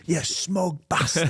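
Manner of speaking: a hint of an abusive fan; mock heckler jeer. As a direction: jeer like an angry fan, drawn out. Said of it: "walk back with a smile".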